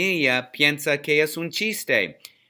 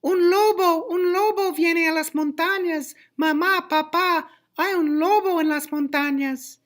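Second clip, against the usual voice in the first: put-on boy shouting. A narrator's reading. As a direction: yell out in a high, piercing boy voice. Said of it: "Adalberto grita".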